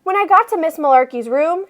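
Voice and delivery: usual speaking voice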